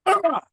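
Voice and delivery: high frustrated cry; exasperated ah. Retold or imitated. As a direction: burst out in annoyance. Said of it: "I'm like".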